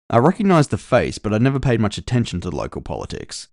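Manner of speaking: ordinary delivery, nothing marked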